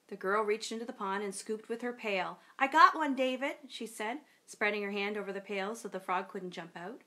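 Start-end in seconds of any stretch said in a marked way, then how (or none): 2.6-3.6 put-on triumphant kid. Girl catches the frog.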